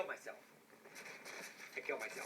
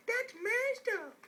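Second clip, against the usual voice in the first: mock shocked squeal; 4 dB louder, slower than usual.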